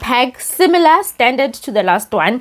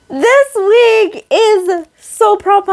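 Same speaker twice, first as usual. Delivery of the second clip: slow, much higher words through real laughter; laughing with joy; 12 semitones higher, much slower than usual. Real, not acted. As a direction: laugh it out, delighted. Laughing joy over the week.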